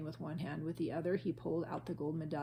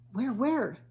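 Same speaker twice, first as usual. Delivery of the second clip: excited; 6 semitones higher, 8 dB louder than usual. A narrator's reading. Jack eager, looking for the whale.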